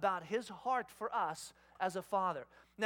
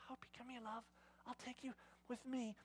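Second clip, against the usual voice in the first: very soft, gentle luring; hushed coaxing; 3 semitones higher, 13 dB quieter than usual. Retold or imitated. Dad to daughter beside sleeping baby, hushed coaxing. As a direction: coax her softly out of the room.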